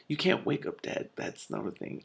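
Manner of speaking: breathy unvoiced whisper to herself; whispered aside. Real, not acted. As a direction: mutter a baffled correction under the breath. Reader's aside correcting the book.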